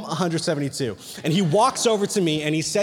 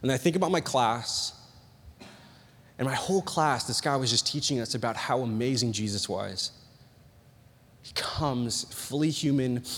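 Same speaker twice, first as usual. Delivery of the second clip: low, soft, measured storytelling; hushed reflective preaching; 7 semitones lower, 6 dB quieter than usual. Real, not acted. Preacher recalling his class on Jesus, hushed and reflective. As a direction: drop low and soft, letting it sink in.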